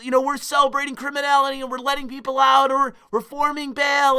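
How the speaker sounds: mocking the jokers. Streamer parodying right-wingers who joke about prison, high loud mockery. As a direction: ham up a gloating conservative cackling at prison jokes. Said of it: "the jokes at the expense of".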